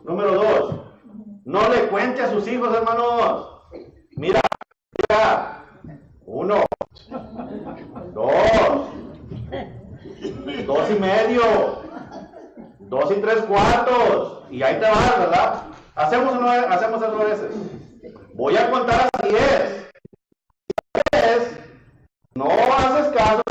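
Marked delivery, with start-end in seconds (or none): 4.2-15.2 mock parent counting. Parent counting to scare child. 18.3-23.4 raised, slow mock warning; drawn-out warning, higher and louder; mock threatening parent. Parent threatening to count to ten.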